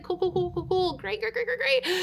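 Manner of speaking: high, soft rapid-fire repeats; mock-casual, high and hushed; overeager agreement. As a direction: play it cool in a squeaky little voice. Acting overly casual about borrowed iPad.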